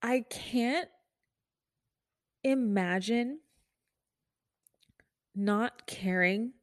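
real choked-up voice, long pauses between words; holding back tears. Real, not acted. Nurse imagining refusing care, holding back tears. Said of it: "I've been crying a lot".

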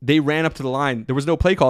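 ordinary talk, nothing marked

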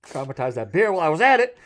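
high, loud Southern boast; hammed-up Southern bragging; blustering Southern dad. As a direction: holler it as a comic Southern dad. Southern dad character boasts, acted.

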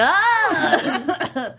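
bright yelp into mic; playful yelp. Retold or imitated. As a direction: let out a squeaky yelp. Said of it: "just me being like".

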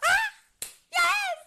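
very high, loud squeal of relief; mock ecstatic mother; overjoyed mom. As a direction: mom rejoicing at the news. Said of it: "her whole mood switched".

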